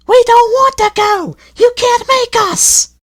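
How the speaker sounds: very high, loud defiant coach voice; stubborn coaches. A narrator's reading. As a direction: refuse like spoilt children. Coaches refuse to move.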